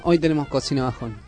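usual speaking voice

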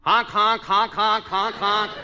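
loud high honking; duck call. Pretend. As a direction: honk like a goose. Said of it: "let's hear your duck call".

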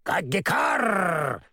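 slow, drawn-out crow cackle; mocking crow laugh; cackling crow caw. A narrator's reading. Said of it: "захохотала она".

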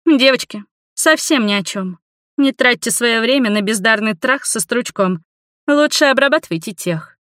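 lightly voiced catty girl; scornful young woman. A narrator's reading. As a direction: dismiss them with lifted, acted disdain. Offended girl mocks the men.

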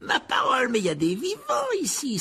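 high-pitched booming astonishment; Sir Patrick exclaiming. A narrator's reading. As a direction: exclaim in mock shock, pitch right up. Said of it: "s'exclama Sir Patrick". (exclamation aiguë, surprise feinte)

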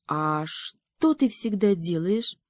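ordinary delivery, nothing marked

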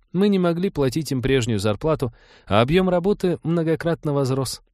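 lightly apologetic woman's explanation; embarrassed justification. A narrator's reading. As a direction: make excuses, a touch embarrassed. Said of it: "принялась смущенно оправдываться".

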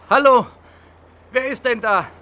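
loud high hail into the dark; man alone by the water at night, calling out loud. Acted. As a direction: shout to find who is there.

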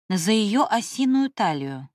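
usual speaking voice

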